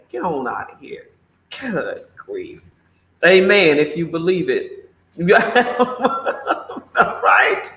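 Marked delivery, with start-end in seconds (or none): 5.2-6.9 airy laugh. Real, not acted. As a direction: laugh at your own joke, lightly. Laughs after amen.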